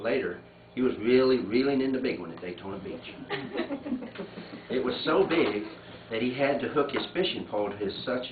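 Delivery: letter read aloud, steady and unhurried. Acted. Reading a condolence letter aloud to the family.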